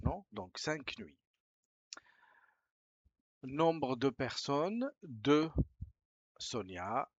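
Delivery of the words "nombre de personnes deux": slow, a bit louder form read. Shown as a demonstration. Teacher reading the booking form aloud, slow and clear. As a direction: read the field out slowly.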